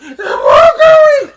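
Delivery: very high, loud wailing screech; crying scream. Retold or imitated. Frustrated child unable to pack her bag, crying scream. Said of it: "crying and just like".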